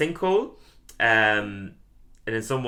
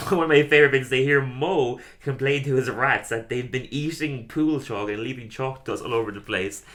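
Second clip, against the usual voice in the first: normal talking voice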